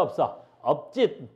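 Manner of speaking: usual speaking voice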